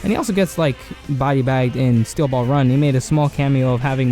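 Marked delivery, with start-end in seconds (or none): none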